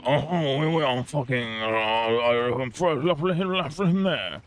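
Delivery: a high, put-on mock French voice; hammed-up mock French gibberish; mock French babble. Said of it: "French people are like".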